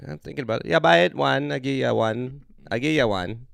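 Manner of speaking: lightly voiced Jamaican market lady, loud and pushy; a hint of a Jamaican accent selling a shirt; Jamaican vendor lady. Swap meet vendor pushing a deal, light Jamaican accent. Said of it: "Jamaican lady at the swap meet".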